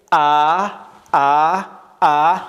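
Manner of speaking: loud drawn-out "a" on "ا"; fatha vowel. Shown as a demonstration. Teacher drills fatha, loud open "a" on "ا".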